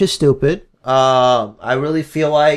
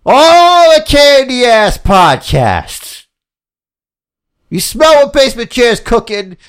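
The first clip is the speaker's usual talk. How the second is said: loud, higher Rock promo voice, slow; The Rock impression; 7 semitones higher, 5 dB louder than usual. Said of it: "You had Rock".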